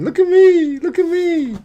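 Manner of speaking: high-pitched singsong taunt; a hint of a childish show-off; taunting dancer. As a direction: tease like a show-off begging for attention. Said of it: "dancing and saying".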